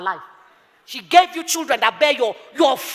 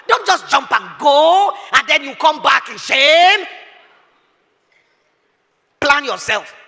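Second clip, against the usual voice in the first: slow emphatic exhortation; 3 semitones higher, 5 dB louder, much slower than usual. Acted.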